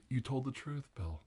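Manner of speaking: normal talking voice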